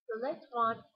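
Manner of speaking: ordinary delivery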